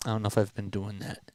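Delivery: normal talking voice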